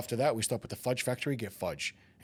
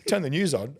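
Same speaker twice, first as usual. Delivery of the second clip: ordinary talk, nothing marked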